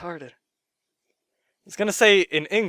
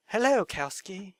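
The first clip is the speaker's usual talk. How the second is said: sexy voice; 4 semitones higher, 7 dB quieter, slower than usual. Retold or imitated. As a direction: purr it slowly, seductively. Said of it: "It's kind of a sexy voice".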